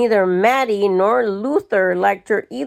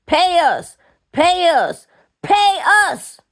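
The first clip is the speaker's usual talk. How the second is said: demand building to a shout; 8 semitones higher than usual. A narrator's reading. Mattie demands wages, rising.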